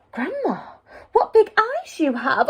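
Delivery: put-on high, wondering girl's voice; amazed little girl. Red noticing the gleaming eyes, amazed girl's voice. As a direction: marvel at it like a child.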